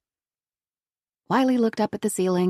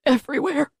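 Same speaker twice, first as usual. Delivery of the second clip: higher, desperate repeated cry; anguished cry; 8 semitones higher than usual. A narrator's reading. Grandfather pleads with the sheriff, anguished cry. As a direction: cry it out in despair. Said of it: "he cried".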